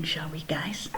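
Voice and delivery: put-on secretive whisper to the kids. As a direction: let them in on a secret, whispering. Conspiratorial aside to kids.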